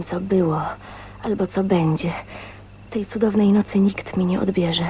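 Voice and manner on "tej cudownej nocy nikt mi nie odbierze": ordinary talk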